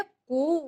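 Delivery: "कु" drawn out as koo; unhurried, stretched oo in "कु"; long oo sound. Shown as a demonstration. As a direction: hold the vowel of "कु" long and easy. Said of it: "ये आराम से बोल जाते".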